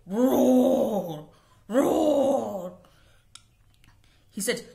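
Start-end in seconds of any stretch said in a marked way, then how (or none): 0.1-2.8 loud roaring cry. Acted. Tiger roars at the fox, loud. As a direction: let out a big roar. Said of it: "suddenly there was a roar".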